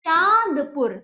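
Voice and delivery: चांदपुर loud, with long nasal चां; emphatic चांद, then पुर; model pronunciation. Shown as a demonstration. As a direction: project चांदपुर for pupils to copy. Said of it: "बोलिए चांदपुर".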